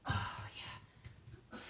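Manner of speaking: strained whisper. Real, not acted. Breathy groan during the exercise.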